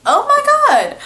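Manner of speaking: squealed high and loud oh my God; freaking out at the memory; mock-panicked squeal; retold panic. Panicking as the girl gets real.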